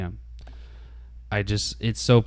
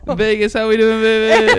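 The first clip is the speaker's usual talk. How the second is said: higher, louder laughing hype host call; late night DJ voice; 11 semitones higher, 6 dB louder than usual. Pretend. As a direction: pump up the crowd like a radio DJ. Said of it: "late night D J voice".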